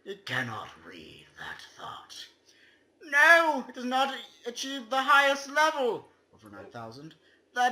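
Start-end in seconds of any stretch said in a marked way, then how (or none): 0.0-2.3 hushed, secretive whisper; spooky whisper. Acted. Reading the game's ending poem aloud, in a whisper. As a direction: breathe it like a secret.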